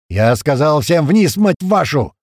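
loud, drawn-out order rising high; angry yell. A narrator's reading. Commander repeating his order to get below, angry yell. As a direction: yell at men who won't obey. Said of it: "заорал Туман".

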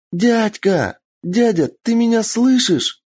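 put-on high girl shouting over wind; little girl voice. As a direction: call out like a scared little girl. Kitsune girl calls out.

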